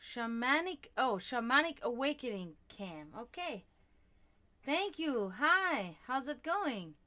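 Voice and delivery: normal talking voice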